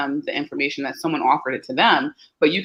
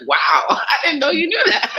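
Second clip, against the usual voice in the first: higher, laughing mock surprise; amused parent surprised by the kid; 12 semitones higher, 4 dB louder than usual. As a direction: laugh in delighted disbelief. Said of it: "And then you're like".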